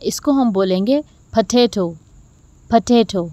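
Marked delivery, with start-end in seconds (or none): none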